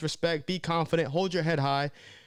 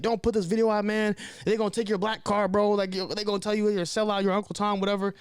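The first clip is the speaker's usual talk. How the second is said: higher voice mimicking angry critics; mocking critics; 7 semitones higher than usual. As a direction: play the community calling him a sellout. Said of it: "they'll be like".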